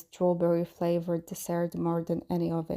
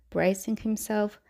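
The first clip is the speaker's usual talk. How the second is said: plain reading voice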